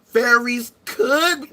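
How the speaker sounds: slow, drawn-out mock musing; mocking believer. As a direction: mimic a vague believer's wondering. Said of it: "We don't say".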